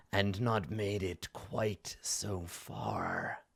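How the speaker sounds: slow villain, trailing into a whisper; imperious villain. Acted. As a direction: draw out each phrase, airy and menacing. Game master voices the NPC.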